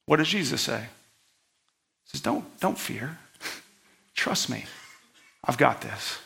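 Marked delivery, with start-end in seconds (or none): 2.2-6.2 soft, low reassurance; calm someone frightened, very softly; hushed, tender comfort with unhurried pauses; gentle. Acted. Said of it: "He's gentle with them".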